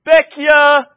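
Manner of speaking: loud, high street cry; vendor yelling. Retold or imitated. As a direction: yell it down the road from a bicycle. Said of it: "and keeps going".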